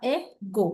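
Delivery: "A, go" in two separate beats; short pause between "A" and "go"; split into syllables. Shown as a demonstration. Teacher dividing the word for students, "A, go" broken in two. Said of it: "ಹೇಗ್ divide ಮಾಡ್ಬೋದು".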